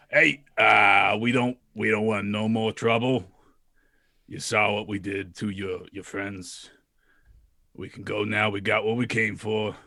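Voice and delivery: put-on tough-guy Brooklyn accent, shouted out. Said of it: "Your Brooklyn accent makes me so happy".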